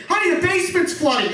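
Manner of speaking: high, urgent household holler; mock a wife shouting up the stairs; wife yelling upstairs. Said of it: "The wife will yell upstairs".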